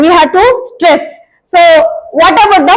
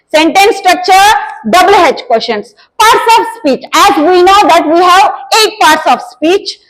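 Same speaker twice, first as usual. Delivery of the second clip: normal talking voice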